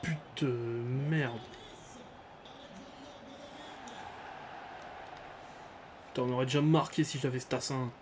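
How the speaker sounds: raging gamer. Real, not acted. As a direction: blow up over the goal. Gamer raging after conceding goal.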